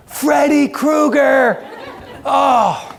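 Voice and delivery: high, drawn-out comic cry of dread; mock alarmed shout. Worker as elevator opens on him, mock alarmed shout. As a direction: re-enact the horrified recognition, high and slow. Acts out seeing the scary man reappear.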